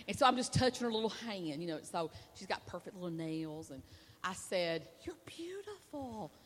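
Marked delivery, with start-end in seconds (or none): 5.0-6.3 slow, soft and high, tenderly. Retold or imitated. Preacher's wife comforting the little old lady, soft and tender. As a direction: say it gently, like a gift.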